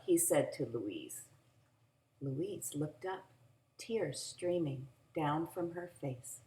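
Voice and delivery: ordinary delivery, nothing marked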